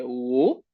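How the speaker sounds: "wo" starting low, then rising. Shown as a demonstration. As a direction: lift the "wo" up from the bottom. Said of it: "y luego sube".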